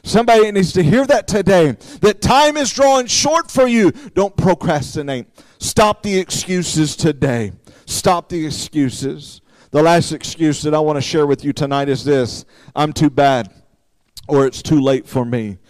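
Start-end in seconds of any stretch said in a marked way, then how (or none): 4.2-15.6 low, hushed pulpit plea; solemn preaching appeal. Real, not acted. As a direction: drop down low and soft to press the warning.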